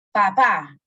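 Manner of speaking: "Papá" said pa-PÁ, loud final syllable; stress on last syllable. Shown as a demonstration. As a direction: hit the second syllable of "Papá". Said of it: "un acento agudo". ("Papá" con acento agudo, en la última sílaba)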